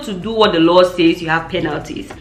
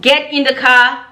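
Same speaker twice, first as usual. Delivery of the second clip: raised-pitch big-sister command; retold firm order; 6 semitones higher, slower than usual. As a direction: order her off, pausing between phrases.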